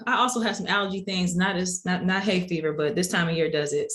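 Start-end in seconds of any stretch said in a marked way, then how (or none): none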